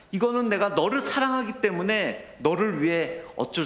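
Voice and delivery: high, strained and slowing, near breaking; tearful plea. Retold or imitated. Father explains the painful test to his son, tearful. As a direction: cry it out for his sake. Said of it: "제가 눈물을 흘리면서".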